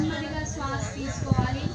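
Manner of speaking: hushed yoga breath guidance; soft spa whisper. Acted. Guides slow breathing softly.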